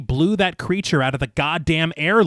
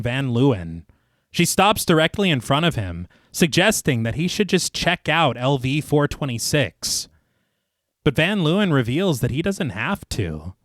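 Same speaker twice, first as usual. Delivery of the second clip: measured, lower narrator's read; story narration; 3 semitones lower than usual. A narrator's reading. Narrator recaps the inquiry scene for listeners, measured storytelling. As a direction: read it like a polished audio storyteller. Reads scripted film recap.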